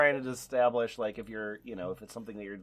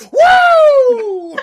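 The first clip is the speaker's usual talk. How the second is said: very loud, high "woo"; wild whoop; 17 dB louder than usual. Acted. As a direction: let out a long high-pitched whoop. Whoop capping the shouted comment.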